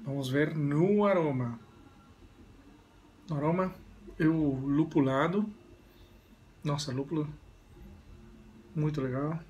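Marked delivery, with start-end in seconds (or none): 1.1-9.4 talking while sniffing beer; glass in front of mouth; speaking into a glass. Real, not acted. Seen 0: Holds beer glass at nose and mouth, sniffing aroma while talking.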